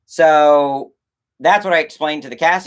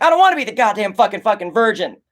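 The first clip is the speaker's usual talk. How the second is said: fast, higher frantic outburst; freaking out; 7 semitones higher, faster than usual. Retold or imitated. Imitating the character losing it: rapid frantic rant. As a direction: spill it out in a panicked rush. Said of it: "he freaks out and he's like".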